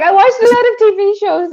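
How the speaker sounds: high laughing retelling. Retold or imitated. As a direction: laugh through the line.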